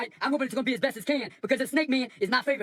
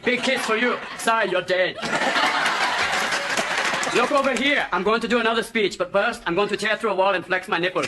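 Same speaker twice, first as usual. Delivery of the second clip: put-on Austrian accent, lower, action-hero Hamlet; Schwarzenegger impression; 5 semitones lower than usual. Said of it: "Schwarzenegger walks into the room".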